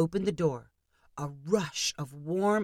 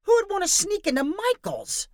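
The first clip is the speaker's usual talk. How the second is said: high, louder incredulous cry; outraged disbelief; 3 dB louder than usual. A narrator's reading. Danny fuming about ending up in Michael's, incredulous. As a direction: scoff at the very idea.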